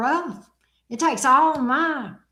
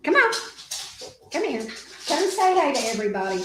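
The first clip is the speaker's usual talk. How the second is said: calling a pet; 2 semitones higher, much faster than usual. Real, not acted. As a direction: call the dog over sweetly. Calling the dog over.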